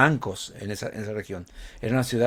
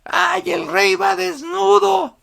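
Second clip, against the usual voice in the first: put-on high, loud, slow child's cry; a child yelling at the parade; child's shout; 8 dB louder, slower than usual. A narrator's reading. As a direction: shout it out like an amazed little boy. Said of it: "un niño gritó".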